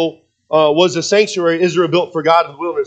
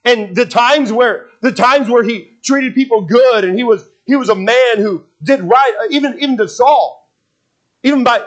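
high, driving sermon voice, stretching key words; impassioned preaching; 8 semitones higher, 3 dB louder than usual. Real, not acted. Preacher telling congregation how David treated Saul right, impassioned. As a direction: preach it with rising fervor.

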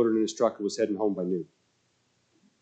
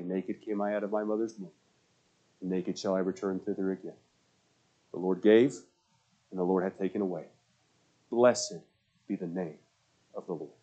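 solemn scripture; 2 semitones lower, 4 dB quieter than usual. Acted. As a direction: read Job's words with reverence. Quoting Job solemnly.

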